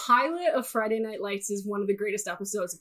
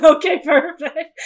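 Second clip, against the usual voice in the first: laughing; 5 semitones higher, 13 dB louder than usual. Real, not acted. Laughing after joke.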